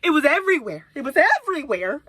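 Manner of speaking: loud, voiced exclamation of dismay; exasperated outburst. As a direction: punch it out loud, appalled. Repeats it aloud after whispering.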